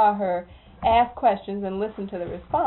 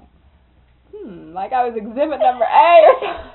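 laughing; 3 semitones higher, 10 dB louder than usual. Real, not acted. Laughing at nephew's stare.

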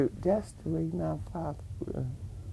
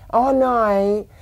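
loud denial; 5 semitones higher, 11 dB louder than usual. Retold or imitated. Father denies he'll slip in church.

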